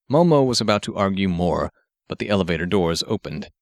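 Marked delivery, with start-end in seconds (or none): none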